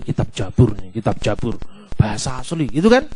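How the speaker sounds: very low, soft murmur; imitated onlooker murmur. Listeners recognising the scripture among themselves, hushed low murmur. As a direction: murmur it under the breath like an onlooker.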